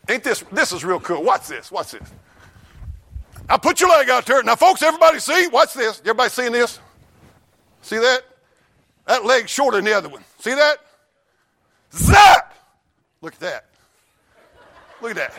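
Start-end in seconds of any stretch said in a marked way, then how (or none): 3.5-10.8 1 mock showman healer. Mocking healer leg trick. 11.9-12.5 louder, much higher sound-effect yell; mock zap shout.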